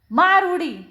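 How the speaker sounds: high, loud call to jump; shouted dare; urging shout. A narrator's reading. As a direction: egg him on. Friend urges jump at well.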